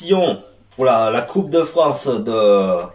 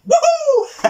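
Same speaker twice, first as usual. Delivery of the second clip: a sudden very high whoop; cheer of joy. Real, not acted. Vlogger celebrating a game finally beaten, high whoop. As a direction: whoop with delight at finally winning.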